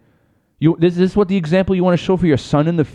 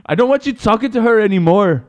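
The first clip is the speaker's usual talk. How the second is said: higher mock-stern wife; Conor's wife; 7 semitones higher than usual. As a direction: forbid him, hamming up jealousy. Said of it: "you Connor's wife".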